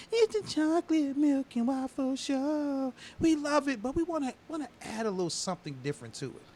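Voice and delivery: sing-song show intro voice; imitating Gemini. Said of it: "She's like".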